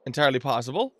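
usual speaking voice

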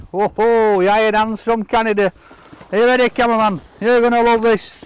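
loud, thrilled announcement of the gift; bursting with delight at the present. Real, not acted.

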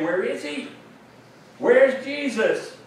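searching crowd. Acted. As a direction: voice a crowd looking around for someone, lightly acted. Crowd searching for Jesus, acted.

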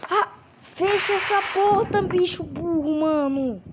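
furious high shout; angry yelling. Real, not acted. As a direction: scream at a careless teammate. Yelling at careless teammate.

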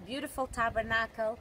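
ordinary talk, nothing marked

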